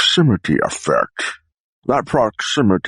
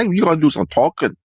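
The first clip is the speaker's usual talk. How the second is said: New York vowel in "talking"; New York accent; 7 semitones higher than usual. Shown as a demonstration. Performer repeats the demo line in a New York accent. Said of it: "a New York, uh, accent".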